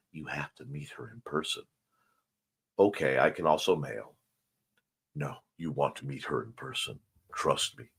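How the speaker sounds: low, soft sinister hint; ominous. Pretend. Sinister joke about meeting her.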